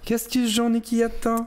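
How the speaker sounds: put-on high, sing-song kid's riddle voice; meme quote voice. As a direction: pitch way up like the viral kid. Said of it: "ça avait pas besoin de remix".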